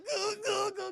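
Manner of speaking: high strangled whimpering yelps; mock agonised squeals. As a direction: squeal in dread, hammed-up. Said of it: "he doesn't want that to happen".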